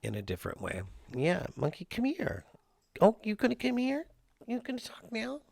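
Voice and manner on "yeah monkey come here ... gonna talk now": talking to the cat. Real, not acted. Owner coaxes the chatty cat in high baby talk. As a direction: coo at the cat to come and talk.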